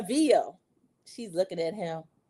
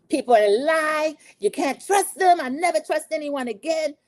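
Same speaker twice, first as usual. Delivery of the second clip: hammed-up betrayed woman; 7 semitones higher, 3 dB louder than usual. Maura's confessional after betrayal, hammed-up wail. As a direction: draw out each phrase in theatrical hurt. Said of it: "[name], do this for Maura".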